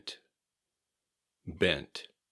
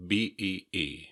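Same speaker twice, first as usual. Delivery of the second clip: ordinary talk, nothing marked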